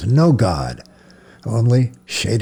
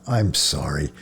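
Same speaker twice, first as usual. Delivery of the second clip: soft, lower, sincere I'm sorry; gentle apology; 6 semitones lower, 5 dB quieter than usual. A narrator's reading. Ashamed priest apologizing to the frightened boy, soft. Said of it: "Mahler shook his head at himself and smiled".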